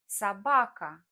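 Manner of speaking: собака said so-BA-ka, stress on ba; soBAka, hit the middle; stress on the middle syllable. Shown as a demonstration. Teacher shows learners: собака stressed in the middle. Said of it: "For example".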